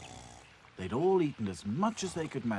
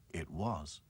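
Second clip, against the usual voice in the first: ordinary delivery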